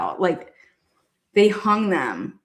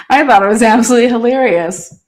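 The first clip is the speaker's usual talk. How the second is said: said out loud, louder, after a laugh; 4 semitones higher, 6 dB louder than usual. Real, not acted. Owning up that the prank was hilarious, louder. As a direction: declare it proudly.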